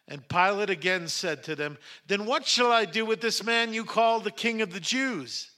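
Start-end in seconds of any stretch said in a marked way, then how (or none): 2.1-5.5 higher-pitched, pressing question; urgent appeal. A narrator's reading. Pilate questions the crowd about Jesus, urgent appeal. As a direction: put it to a hostile crowd, voice raised.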